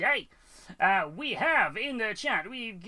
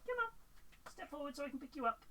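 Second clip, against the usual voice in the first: much higher sing-song voice for the dog; high doting voice greeting the dog; pet talk; 8 semitones higher, 13 dB quieter than usual. Real, not acted. Coaxing the dog to be picked up.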